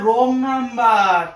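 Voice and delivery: রং number drawn out word by word, very slow; spelled out slowly. Shown as a demonstration. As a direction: stretch রং number so she finally gets it. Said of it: "বুঝছো না কেন ব্যাপারটা?".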